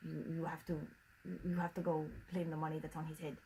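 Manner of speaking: put-on a jokey send-up of the wife's French Canadian accent; mock French Canadian accent. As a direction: mimic the wife in a bad French Canadian accent. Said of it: "That was me doing her accent".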